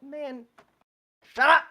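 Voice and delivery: high pitch bursting into a loud "shut up"; fed up, explode on the last words; exasperated outburst. Retold or imitated. Podcast host acting out his reaction to the hero, exasperated shout. Acts out own reaction to character.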